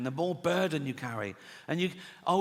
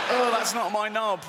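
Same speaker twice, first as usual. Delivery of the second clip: higher-pitched startled exclamation; acted dismay; 5 semitones higher, 3 dB louder than usual. Man looking down at his transplanted body, mock dismay. Repeated look down, heightened reaction.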